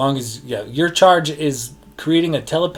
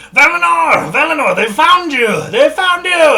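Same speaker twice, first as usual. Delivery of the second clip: frantic scream, much higher and louder; dwarf screaming; 12 dB louder than usual. Acted. Dwarf drawing his weapon, screams his warning at Valinor. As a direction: scream the alarm as if fleeing. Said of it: "begins screaming".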